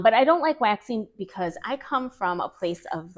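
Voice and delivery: normal talking voice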